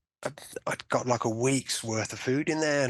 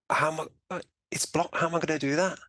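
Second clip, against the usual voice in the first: higher-pitched, baffled retort; retelling a bewildered objection, pitch raised; incredulous protest; 4 semitones higher than usual. Retold or imitated. Patient answering doctors about being blocked, incredulous.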